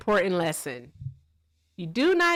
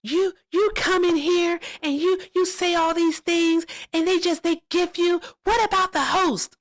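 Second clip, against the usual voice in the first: high, loud mock angry lady; imitating a mad lady from the live, high and loud; angry lady impression; 11 semitones higher, 3 dB louder than usual. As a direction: squawk it like the upset lady. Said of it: "She was like".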